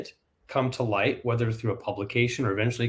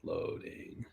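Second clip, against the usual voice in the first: whispered; 17 dB quieter than usual. Real, not acted. Waiting for the page to load.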